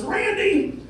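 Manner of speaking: high squeaky call of Randy; Carl calling out. Acted. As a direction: yelp his friend's name up high. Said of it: "he says".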